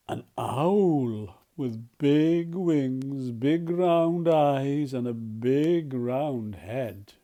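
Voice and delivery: slow, wide-eyed wonder; slow hushed amazement; awed gasp. Acted. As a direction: draw out the big round owl in awe. Gasp, awed owl reveal.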